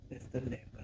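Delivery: a sheepish whisper to herself; muffled confession; muttered aside. Acted. Hushed repeat as a private aside.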